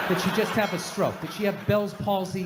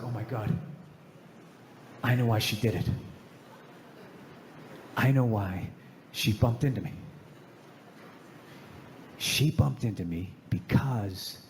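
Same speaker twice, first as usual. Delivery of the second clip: hushed revelation; 11 semitones lower, 3 dB quieter than usual. Retold or imitated. Sudden realisation at sink.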